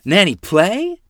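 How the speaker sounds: put-on high, loud toddler begging to play; a tiny girl asks eagerly, about to giggle; toddler asking. A narrator's reading. Lottie asks her aunt to play, high and eager toddler voice. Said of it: "as she giggled in expectation".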